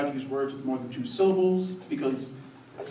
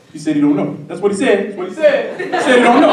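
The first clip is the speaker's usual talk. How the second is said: loud echoing hype, climbing higher; hype man; 8 semitones higher, 10 dB louder than usual. Retold or imitated. Skinny coach backs up his partner, shouted hype. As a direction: repeat it louder and higher like a sidekick. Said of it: "more of the hype man".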